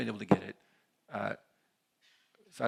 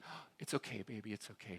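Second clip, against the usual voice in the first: put-on soft, hushed soothing of a scared baby; calm the baby down gently; soothing mom; 10 dB quieter than usual. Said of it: "She starts soothing the baby".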